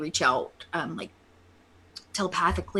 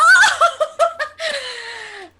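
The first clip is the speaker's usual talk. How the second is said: burst of laughter; 8 dB louder than usual. Real, not acted. Player laughs at nat twenty.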